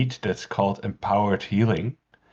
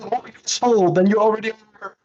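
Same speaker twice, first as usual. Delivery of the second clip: high, louder mock pep talk; acted exhortation; 11 semitones higher, 5 dB louder, faster than usual. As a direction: pitch it up and push it out. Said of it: "I'm like".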